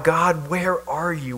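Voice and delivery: slow, pleading cry to an absent God. Acted. A captive crying out to God, slow and pleading. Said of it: "So we cry out".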